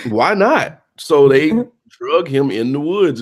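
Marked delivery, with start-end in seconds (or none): none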